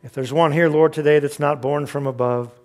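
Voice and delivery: soft, unhurried pastoral prayer; closing prayer. Acted. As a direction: pray it quietly and earnestly over the church.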